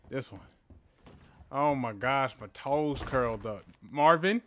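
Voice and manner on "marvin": loud, higher call of a name; yelling for Marvin while scared. Real, not acted. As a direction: call out sharply to a friend. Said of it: "Sorry I'm yelling".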